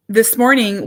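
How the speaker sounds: ordinary talk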